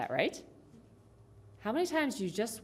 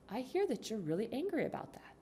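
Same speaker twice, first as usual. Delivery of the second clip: higher, softer, patient reply; calm validating; 3 semitones higher, 8 dB quieter than usual. Acted. Parent to pissed-off teenage daughter, calmly validating. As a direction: answer the outburst gently and evenly.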